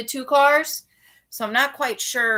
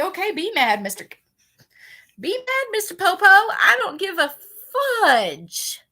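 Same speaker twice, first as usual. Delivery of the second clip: sarcastic taunt; 10 semitones higher, 4 dB louder than usual. Retold or imitated. Mocking the cop.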